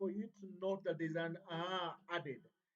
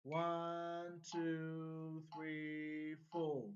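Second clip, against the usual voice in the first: metronome count; 3 semitones lower, 3 dB quieter, much slower than usual. Shown as a demonstration. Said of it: "We bring back our metronome".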